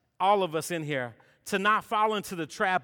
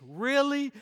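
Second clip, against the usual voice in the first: higher, stunned "really?"; incredulous really; 6 semitones higher than usual. Retold or imitated. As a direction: stretch "really?" in disbelief. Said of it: "Real, lift tickets".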